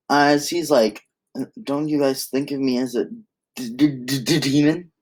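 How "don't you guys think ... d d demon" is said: acted scared four-year-old, tripping on "demon"; scared stutter. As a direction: stammer the last word like a frightened little kid. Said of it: "gotta put in that st-, stutter".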